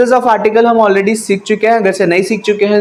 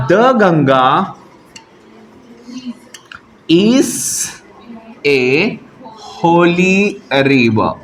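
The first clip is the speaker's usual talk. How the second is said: slow dictation with long pauses between words; much slower than usual. Shown as a demonstration. Teacher dictates the corrected answer to students, slowly. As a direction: spell out the answer slowly for writing. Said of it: "answer हमारा क्या होगा फिर?".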